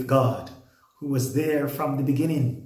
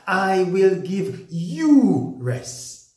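"I will give you rest" higher, louder, slow, punching "you"; emphatic promise; 6 semitones higher, 5 dB louder, much slower than usual. Acted. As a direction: press "you" as a personal promise, slowly. Preacher stresses personal promise.

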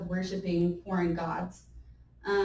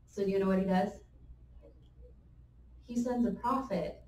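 ordinary talk

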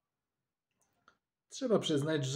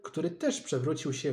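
ordinary delivery, nothing marked

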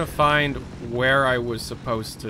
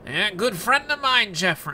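Trump impression; 7 semitones higher than usual. Streamer retrying his Trump bit, a lightly voiced Trump. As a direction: push the Trump voice harder, still missing. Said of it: "That doesn't sound like Trump".

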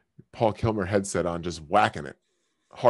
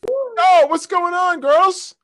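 much higher and louder, squealing party-host greeting; mock hype; 9 dB louder, slower than usual. Greeting girls on a random video chat, squealing mock hype. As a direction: whoop it up like a hyped host.

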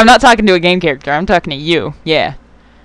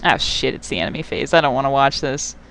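ordinary talk

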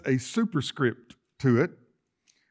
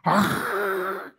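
short, higher, emphatic "ah" grunt, imitated; translator's emphatic ah; 7 semitones higher, 3 dB louder than usual. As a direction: mimic a preacher's punchy ah. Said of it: "he started going".

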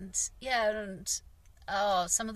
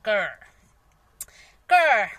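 frustrated growl; 9 dB louder than usual. Retold or imitated. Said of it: "and I'm like".

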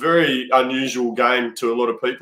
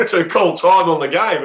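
higher, laughing through the punchline; 5 semitones higher than usual. Real, not acted. Storyteller delivering the hooter punchline, laughing. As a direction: let the laugh break into the question.

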